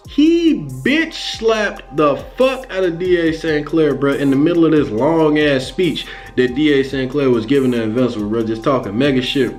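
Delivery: ordinary delivery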